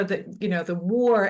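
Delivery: normal talking voice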